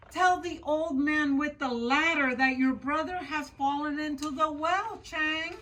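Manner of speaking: high, loud frantic wail; panicked mother. Acted. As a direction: cry out in horror, then hurry him. Mother panicking over fallen son.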